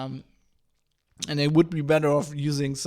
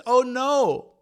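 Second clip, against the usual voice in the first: mock dismay; 7 semitones higher, 4 dB louder than usual. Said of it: "mock dismay at GitHub's wording".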